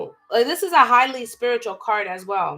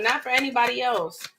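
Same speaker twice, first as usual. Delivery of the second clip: ordinary talk, nothing marked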